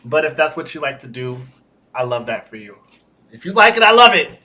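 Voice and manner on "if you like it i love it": high, loud emphatic burst; excited. Real, not acted. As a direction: proclaim it brightly.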